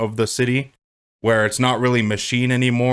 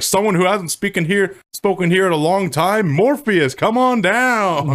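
high, building announcer impression; hammed-up introduction, stretching the last call; hype man; 7 semitones higher, 3 dB louder than usual. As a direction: work the crowd like a mock hype man. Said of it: "He's Morpheus's hype man".

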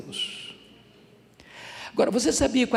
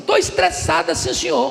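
mock-indignant little girl, high and loud; menina estressada; 14 semitones higher, 6 dB louder than usual. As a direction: imitate a child insisting she is stressed. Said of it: "uma menina de onze anos". (imitação de menina teimosa, aguda e forte)

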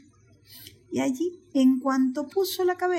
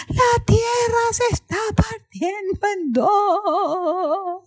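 high, loud, slow wailed chant; animals in chorus; 7 semitones higher, 6 dB louder, much slower than usual. Acted. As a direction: chant it out, wobbling the long last words. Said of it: "todos en coro le dijeron". (coro de animales, agudo y muy alargado)

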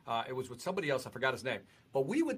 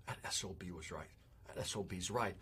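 low, soft, grudging mutter; grudging admission; 6 semitones lower, 9 dB quieter than usual. Retold or imitated. Guest admits the mentor was right, low grudging mutter. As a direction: concede it quietly through your teeth. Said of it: "And I go".

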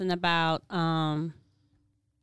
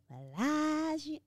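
higher, softer drawn-out "Bellagio"; "Bellagio" savored in a high, soft voice; playful echo; 7 semitones higher, 9 dB quieter, slower than usual. Pretend. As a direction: stretch "Bellagio" slowly, lifting it high and soft. Echoes the wine name twice, playfully.

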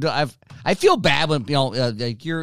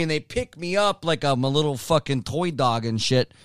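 ordinary talk

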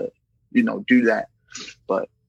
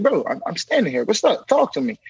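much higher, gentle pleading; pleading concern; 9 semitones higher than usual. Retold or imitated. Officer urging the inmate to talk, high and pleading. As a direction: keep gently urging him to open up.

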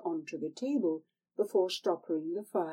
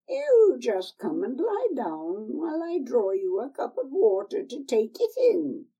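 put-on higher, wheedling old hag voice; old crone; 6 semitones higher, 6 dB louder than usual. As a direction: coax her like a sly old crone. Old woman speaks again.

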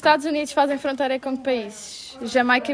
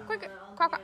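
high, soft quacking; duck quack imitation; 4 semitones higher, 10 dB quieter than usual.

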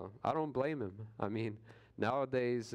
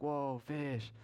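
sudden high delighted cry of whoa; mock excited shout, pitched up; 5 semitones higher than usual. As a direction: call out as if you just spotted a fish jumping. Excited sighting of a fish.

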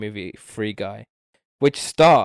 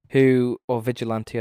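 ordinary talk

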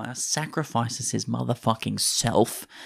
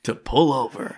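through a megaphone; 3 semitones higher, 4 dB louder than usual. Pretend. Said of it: "megaphone like a police car".

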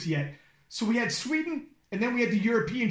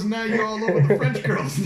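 laughing; 7 dB louder than usual. Retold or imitated. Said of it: "is like".

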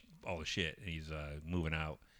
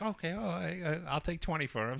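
high, hesitant caving voice; a lightly voiced seller giving in; flustered old lady; 9 semitones higher than usual. Garage-sale lady dropping her price, high and flustered.

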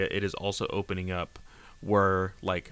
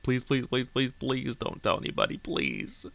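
mock begging; 4 semitones higher than usual. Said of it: "on his knees begging".

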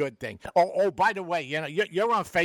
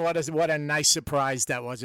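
ordinary talk

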